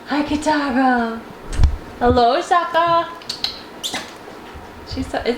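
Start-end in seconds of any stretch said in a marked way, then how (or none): 0.1-3.1 slow, higher sing-song hello to the cats. Real, not acted. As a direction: greet each kitty sweetly and slowly. Greeting each cat sweetly.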